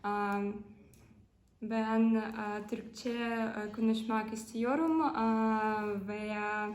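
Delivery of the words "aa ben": normal talking voice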